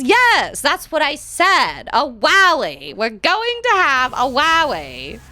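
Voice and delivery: "wowie" said with w for r, high and loud; small mouse voice saying "wowie" for rally; can't say her R's. Retold or imitated. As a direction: lisp the R into a W like a little mouse. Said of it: "she can't say her R's".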